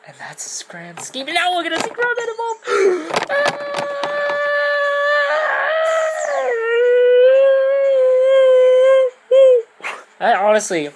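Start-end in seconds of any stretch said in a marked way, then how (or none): 0.1-1.3 conspiratorial whisper. Acted. Conspiratorial aside after quote. 1.3-2.6 hyped announcer. Acted. 2.6-10.1 long high shrieking gasp. Acted. Dramatic reaction to evolution.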